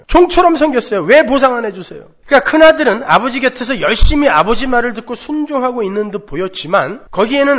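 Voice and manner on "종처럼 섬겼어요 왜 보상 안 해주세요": high, loud, punching 종처럼 then 왜; aggrieved demand. Retold or imitated. Elder son demanding payment from his father, high aggrieved whine. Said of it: "이야기하는 거예요".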